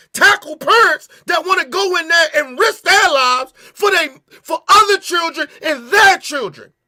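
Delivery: loud, high, furious ranting; angry rant. Real, not acted. Enraged rant about police.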